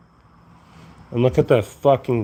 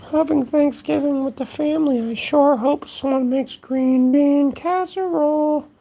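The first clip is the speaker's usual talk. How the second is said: much higher cartoon voice; silly high voice; 13 semitones higher than usual. Vlogger replays his Thanksgiving line in a much higher goofy voice. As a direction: squeak it out like a goofy character. Repeat in squeaky put-on voice.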